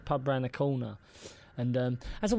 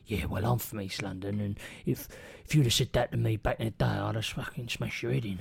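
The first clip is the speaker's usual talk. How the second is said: low, softer hard-man menace; mock aggressive; 8 semitones lower, 5 dB quieter than usual. Bloke in the pub threatening the comedian, low and menacing. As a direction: lean in with quiet East London threat. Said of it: "dead aggressive, he's gone".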